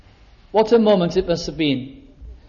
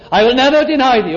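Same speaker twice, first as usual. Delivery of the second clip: higher, louder and fast, protesting loyalty; emphatic protest; 5 semitones higher, 4 dB louder, faster than usual. Acted. Peter vows to Jesus, a higher, louder, fast protest. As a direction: insist fiercely, a little rushed.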